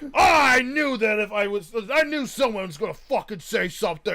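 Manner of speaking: hammed-up shout as the annoyed father; mock angry dad. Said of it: "He got so mad at me".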